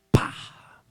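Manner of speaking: sharp loud voiced bang of a brick. Preacher imitates a brick striking the car, loud smack. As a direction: smack the sound out like a brick hitting.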